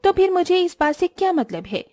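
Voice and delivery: high, self-important retort; entitled daughter-in-law insisting. Acted. (ज़िद्दी बहू का ऊँचा, तीखा जवाब)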